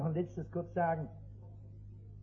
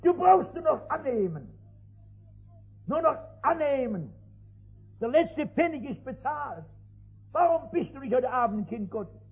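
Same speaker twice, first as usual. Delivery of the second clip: raised, louder preacher's plea; preaching at full force; 4 semitones higher, 6 dB louder than usual. Acted. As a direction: press the gospel home, loud and high. Preaching to the convert. (laut predigend, eindringlich)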